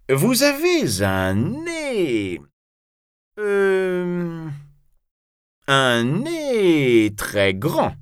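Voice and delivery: high, slow, halting provocation; drawn-out challenger's taunt; spoiling for a fight. A narrator's reading. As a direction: pick a fight, dragging out the insult. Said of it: "avec la voix d'un homme qui veut se battre".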